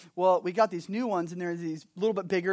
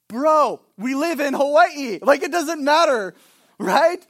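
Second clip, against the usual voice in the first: louder, higher disbelieving pushback; incredulous bro; 6 semitones higher, 8 dB louder than usual. Retold or imitated. Pastor retelling his reply to a friend, mock disbelief. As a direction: scoff at heated seats in Hawaii.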